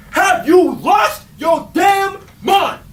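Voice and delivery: high, slow hammed-up shout; mock outraged shout. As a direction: yell it in theatrical disbelief. Co-host explodes at the pick.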